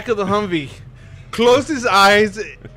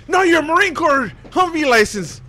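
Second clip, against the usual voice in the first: higher-pitched mock officer correction; imitated demanding officer; 9 semitones higher than usual. Officer demanding license, retold.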